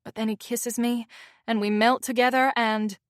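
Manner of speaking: plain reading voice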